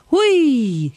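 high, loud squeal of fun. A narrator's reading. Little bear slides down a seal's back, squealing. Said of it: "quietschte er".

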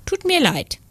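high-pitched, regretful apology; smiling regret. A narrator's reading. Cloud apologising to the little sunbeam, smiling regret. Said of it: "Die Wolke lächelte bedauernd".

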